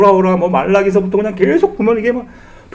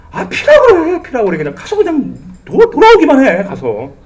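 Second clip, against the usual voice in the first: exasperated outburst; 7 semitones higher than usual. Retold or imitated. Preacher's exasperated retort.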